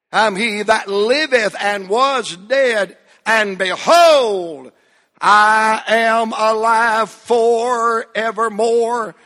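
slow, drawn-out scripture proclamation; slow preacher's reading of scripture; solemn scripture reading. Acted. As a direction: stretch every word of the verse.

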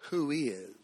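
low, soft Who is?; hushed reading. Real, not acted. Preacher reading the verse softly to his listeners. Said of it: "Listen to this line".

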